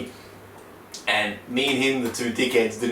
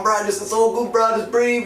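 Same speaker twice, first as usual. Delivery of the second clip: higher, louder mock instructor telling him to breathe; hammed-up soothing instructor, pitched up; calming instructor; 10 semitones higher, 3 dB louder than usual. Retelling the instructor calming him midair, a higher hammed-up voice.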